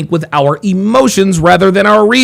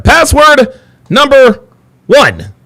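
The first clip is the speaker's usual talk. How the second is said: slow, loud game-show reveal of the number; big announcement; 9 semitones higher, 3 dB louder, slower than usual. Acted. Host to viewers, revealing password one: slow, loud game-show announcement.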